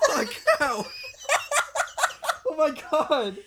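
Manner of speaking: high, loud helpless laughter; laughing to tears. Real, not acted. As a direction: laugh so hard it hurts. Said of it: "alcohol in my tears. My eyes are burning".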